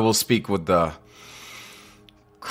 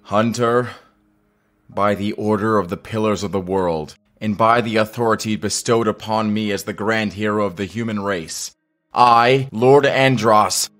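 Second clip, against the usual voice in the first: raised, declaiming arrest announcement; formal proclamation; 2 semitones higher, 4 dB louder than usual. Acted. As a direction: proclaim it for all the troops to hear. Formal arrest before troops.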